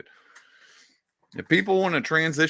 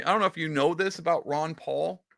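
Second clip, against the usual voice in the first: usual speaking voice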